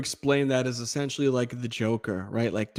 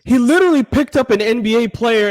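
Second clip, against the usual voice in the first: raised, incredulous exclamation; loud disbelief; 6 semitones higher, 8 dB louder than usual. Real, not acted. Co-host recalling a player being picked up, loud and incredulous. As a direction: hammer it out loudly, amazed.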